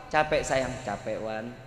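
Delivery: soft, low and gentle, voicing a loving wife; a wife fussing softly over her tired husband; tender wife voice. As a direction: murmur it sweetly like a doting wife.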